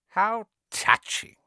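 high, slow, mocking hiss. A narrator's reading. Evil face mocking Harry, hissing. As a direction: hiss it out slowly. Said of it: "it hissed".

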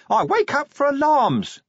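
the imp bursting out of its box; shrieking imp. Acted. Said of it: "the imp shrieked".

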